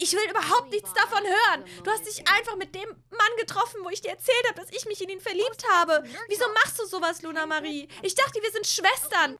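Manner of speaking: high, loud betrayed tirade; hurt fury. Acted. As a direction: rant at her, wounded. Sisters' acted quarrel.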